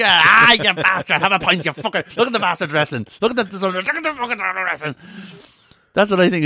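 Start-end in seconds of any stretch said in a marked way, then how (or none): 0.0-4.9 put-on drunk Irish punter. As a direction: jeer at the screen like a drunk in the pub. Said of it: "one of the guys going".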